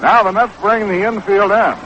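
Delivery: normal talking voice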